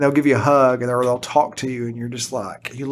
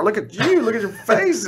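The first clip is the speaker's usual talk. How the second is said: gushing over a grown-up; 8 semitones higher than usual. Retold or imitated. Gushing over grown former students.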